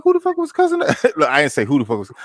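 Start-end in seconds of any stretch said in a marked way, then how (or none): 0.0-1.0 high, loud, rapid demand; retold outburst, fast and high-pitched; indignant dad. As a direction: fire off an outraged question. Acted angry father questioning his son.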